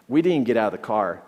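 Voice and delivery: ordinary delivery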